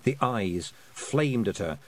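usual speaking voice

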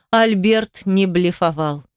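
plain reading voice

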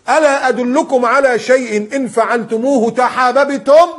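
preacher intoning a hadith; solemn hadith recitation. Shown as a demonstration. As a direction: recite it slowly and loudly from the pulpit. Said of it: "قال صلى الله عليه وسلم".

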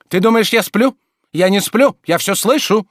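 high, loud protest; indignant. A narrator's reading. Master protesting to servant he is awake, indignant. Master angrily denying he sleeps.

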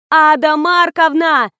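high, loud, drawn-out call; yelling. A narrator's reading. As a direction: holler the name to a deaf woman. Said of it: "завопила я".

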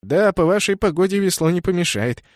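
wry smirking reply; grinning. A narrator's reading. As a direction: joke back with a grin. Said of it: "ухмыльнулся в ответ Кеннет". (с ухмылкой)

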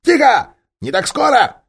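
high, loud ape orders; high loud ape shout. Acted. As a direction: bark them back. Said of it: "обезьян закричал".

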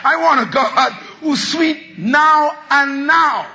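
full-force preaching cry; preaching shout. Real, not acted. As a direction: drive it home to the congregation.

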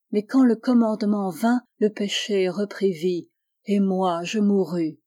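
ordinary delivery, nothing marked

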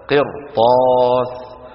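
قرطاس drawn out very slowly with a thick ra; heavy ra recitation. Shown as a demonstration. Teacher reciting an example to students, heavy ra. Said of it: "وبعدها حرف استعلاء غير مكسور".

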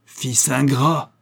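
enraged father cries out at his son; furious cry. A narrator's reading. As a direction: spit the words out in fury. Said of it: "s'écria l'ingénieur blême de fureur".

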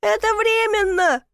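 high-pitched indignant call; indignant shout. A narrator's reading. Stuck man shouting from the chimney, indignant shout. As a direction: holler back with wounded pride. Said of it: "возмущённо крикнул я из трубы".

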